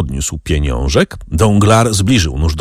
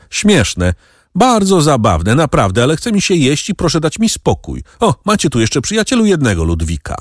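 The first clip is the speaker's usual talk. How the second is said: higher-pitched, huffy protest; bristling; 7 semitones higher than usual. A narrator's reading. Hungry captive waving off the bandit's joke, bristling. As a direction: brush it off with raised-pitch irritation. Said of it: "żachnął się Donglar".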